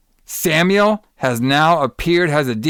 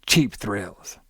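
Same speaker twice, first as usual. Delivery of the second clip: low, soft sinister tape voice; tape's voice; 6 semitones lower, 6 dB quieter than usual. Retold or imitated. The cursed tape answering Eddie, low and soft. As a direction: let the evil tape answer low and quiet. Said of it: "the tape's like".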